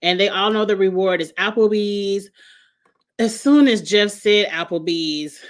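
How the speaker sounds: ordinary delivery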